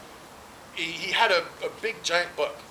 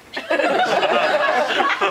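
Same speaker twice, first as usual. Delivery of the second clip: a big loud burst of laughter; 10 semitones higher, 6 dB louder than usual. Retold or imitated. The agent laughing loud at the caller's spelling. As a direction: crack up loud and high.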